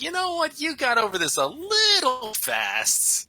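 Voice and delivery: high, slow, pointed mock voice; sarcastic retort. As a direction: drawl it out with exaggerated indignation. Imagined retort to ex.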